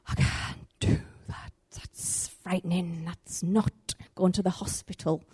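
soft, slow, half-whispered fear; frightened refusal. Retold or imitated. Minister recoiling from God's prompt, hushed and fearful. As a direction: shrink back from the task.

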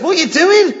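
high, loud alarmed question; startled wife. Retold or imitated. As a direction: demand it in loud disbelief, as wife. Acts startled wife's question.

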